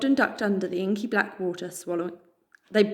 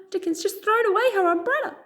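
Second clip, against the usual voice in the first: much higher, outraged disbelief; can you believe he did that; shocked aside; 13 semitones higher, 3 dB louder than usual. Real, not acted.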